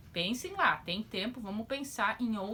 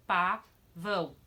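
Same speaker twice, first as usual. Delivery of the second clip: slow example word; 2 semitones lower, 5 dB louder, much slower than usual. Shown as a demonstration. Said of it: "Olha lá os exemplos".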